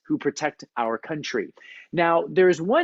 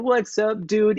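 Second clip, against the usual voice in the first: laid-back dude; 7 semitones higher than usual. Retold or imitated. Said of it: "loose, easygoing".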